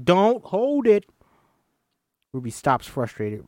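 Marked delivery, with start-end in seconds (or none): 0.0-1.1 loud, slow, high "don't hold it"; stretched-out coaching command; slow emphatic coaching. Acted. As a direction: drag it out firmly. Coach repeats the instruction.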